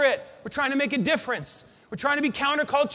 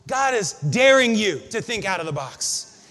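normal talking voice

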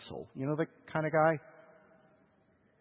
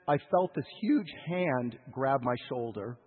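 normal talking voice

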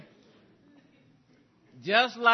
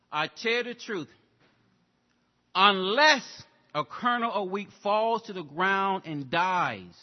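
scripture reading; 5 dB louder than usual. Acted. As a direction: read it like a preacher proclaiming the text. Reads scripture aloud.

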